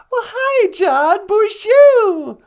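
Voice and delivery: high, loud squeal of hello; excited greeting. Real, not acted.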